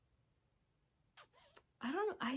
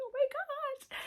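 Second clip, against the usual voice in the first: much higher, excited squeal; squealing giddy; slower than usual. Retold or imitated. Fan squealing at the movie, high giddy squeal. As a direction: squeal like a fangirl over the actor.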